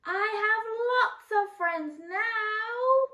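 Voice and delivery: high slow echoing call; loud high echo from across the pond. Acted. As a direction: answer back like a distant echo. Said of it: "a voice answered him".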